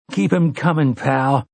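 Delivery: hoarse, close to tears; high tearful rasp. Acted. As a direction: choke it out, throat full of tears. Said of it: "Eddie said hoarsely".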